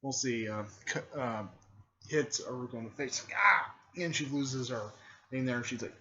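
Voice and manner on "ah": short, loud, high yelp; mock cry of pain. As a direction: yelp as if hit in the face. Character is hit in the face.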